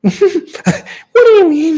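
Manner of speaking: high, slow, cackling villain; shrill manic laughing clown; Joker voice. Pretend. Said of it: "I wanna do the Joker voice".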